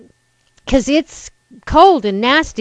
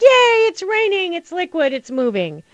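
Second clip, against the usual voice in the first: mock delight; 9 semitones higher than usual. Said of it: "I'll be like".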